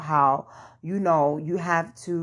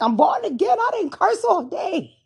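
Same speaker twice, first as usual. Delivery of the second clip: much higher, louder, thrilled; excited; 4 dB louder than usual. Retold or imitated. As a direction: burst out with giddy joy. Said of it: "I'm like".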